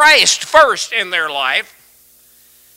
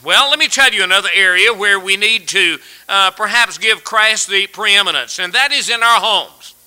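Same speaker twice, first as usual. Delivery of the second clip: usual speaking voice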